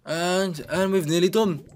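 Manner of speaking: high, loud and hopeful; excited anticipation. Real, not acted. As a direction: call it out as victory nears. Gamer nears end of jumps.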